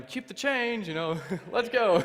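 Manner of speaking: loud, high, hurried to be gone; giddy dismissal. Retold or imitated. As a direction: toss it off loudly with a laugh. Throws money, eager to leave prison.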